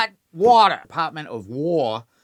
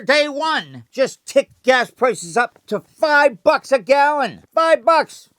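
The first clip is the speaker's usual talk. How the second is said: much higher, louder heated rant; 9 semitones higher, 6 dB louder than usual. Acted. Angry host ranting about gas prices, loud and high. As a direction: build to outrage.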